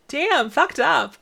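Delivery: loud, slow, laughing curse. Real, not acted. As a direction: swear through laughter. Still laughing.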